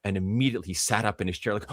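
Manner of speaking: ordinary talk, nothing marked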